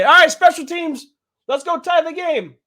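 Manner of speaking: high, loud hammed-up coach rallying; mock coach call. Mocking the coach's call to kick, high loud mock rally. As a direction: play a naive coach sending out the kicker. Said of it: "and then say".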